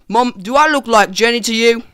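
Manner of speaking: mock-British exasperation; British accent. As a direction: object as a fed-up British child. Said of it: "pioneers had British accents in that scene".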